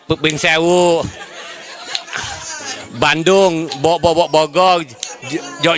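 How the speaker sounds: normal talking voice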